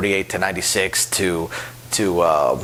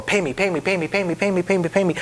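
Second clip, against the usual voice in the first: whiny high chant of demands; mock impatient player; 9 semitones higher than usual. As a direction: badger the dealer over and over. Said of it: "don't start shouting at the dealer".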